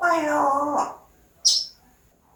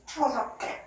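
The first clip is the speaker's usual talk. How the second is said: usual speaking voice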